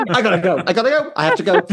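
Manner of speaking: high, urgent, hammed-up excuses; mock flustered exit. Acting out her running out, mock flustered.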